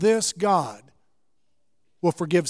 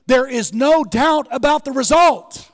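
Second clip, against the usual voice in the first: loud, high declaration, RESULT hammered; the refrain now shouted from the pulpit; preached at full force; 6 semitones higher, 6 dB louder than usual. Acted.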